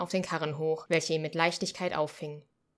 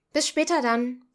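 called out, higher and louder; calling out; 8 semitones higher, 8 dB louder than usual. Acted. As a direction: call back to the kitchen while leaving. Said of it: "rief ich der Küche im Hinausgehen zu".

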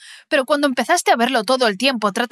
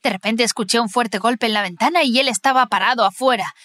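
usual speaking voice

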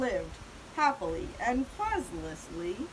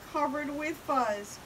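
usual speaking voice

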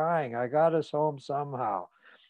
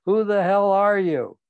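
loud, higher, furious yelling; furious scream; 6 semitones higher, 7 dB louder than usual. A narrator's reading. Father catching the intruder in the bedroom, furious scream. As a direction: scream it at him, outraged. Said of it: "He screamed at me".

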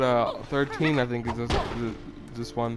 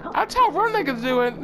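excited boast; 14 semitones higher, 3 dB louder than usual. Real, not acted. Boastful squeal.

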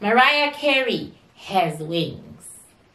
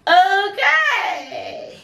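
laughing okay; 10 semitones higher, 3 dB louder than usual. Real, not acted. Laughing burst on seeing her.

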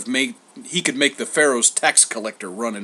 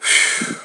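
long breathy exhale; weary sigh; 5 dB louder than usual. A narrator's reading. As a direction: sigh heavily. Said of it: "Homer sighed".

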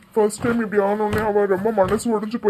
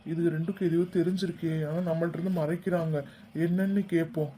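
hushed, low muttering to oneself; suspicious aside; 4 semitones lower, 7 dB quieter than usual. Acted. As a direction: think aloud softly, keeping it secret. Eavesdropper's suspicious aside to self. (தாழ்ந்த குரலில் சந்தேக முணுமுணுப்பு)

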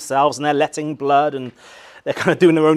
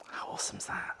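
whisper; 19 dB quieter than usual. Real, not acted. Awed whispered aside.

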